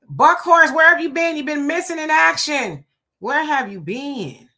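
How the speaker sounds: high, loud greeting to a long-lost regular; playful callout. Real, not acted. Host hails a viewer missing from the chat, playful callout. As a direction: call out in mock demand, stretching the last question.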